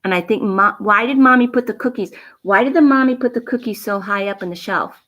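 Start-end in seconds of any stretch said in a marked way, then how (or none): none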